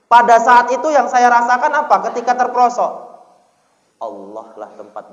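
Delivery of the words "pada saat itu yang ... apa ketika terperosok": loud, rising preacher's call; sermon climax, loud; fiery preaching. Acted. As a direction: lift the congregation with it. Preacher raises voice.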